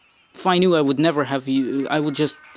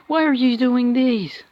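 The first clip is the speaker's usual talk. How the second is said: high, slow, whining complaint; exasperated whine; 9 semitones higher, 4 dB louder than usual. Real, not acted. Player whining at his own troops in despair. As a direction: drag out the whiny why. Frustrated player scolds game.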